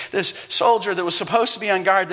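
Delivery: usual speaking voice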